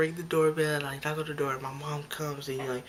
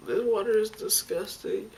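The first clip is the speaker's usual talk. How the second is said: high, loud mock disgust; 7 semitones higher, 6 dB louder than usual. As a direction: recoil from it, loudly grossed out. Acted disgust at the water.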